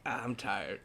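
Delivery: tired murmur. Retold or imitated. Quoting everyone's mood.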